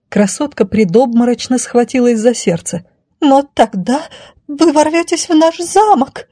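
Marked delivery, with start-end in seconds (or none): 3.2-6.3 high, alarmed damsel voice; high-pitched dismay; alarmed lady. Acted. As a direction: protest in a high, frightened lady's voice. Besieged lady fears the bandits.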